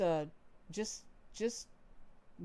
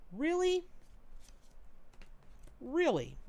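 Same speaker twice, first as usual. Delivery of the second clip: surprised; 8 semitones higher than usual. Real, not acted. Reacting to a drawn card.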